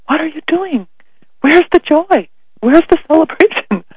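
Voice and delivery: high, loud, incredulous cry; imagined shout of disbelief at mass; indignant outburst. Pretend. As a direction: leap up and protest at the priest. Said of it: "I almost wanted to leap up and say".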